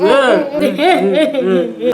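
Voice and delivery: high, repeated fake sobs; imitated whimpering cries; mock sobbing. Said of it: "she crying about it all".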